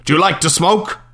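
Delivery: a lightly voiced gruff foreign husband, demanding. Gruff landlord on the phone, demanding. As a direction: bark the question, a touch gruff. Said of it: "her gruff husband, who demanded".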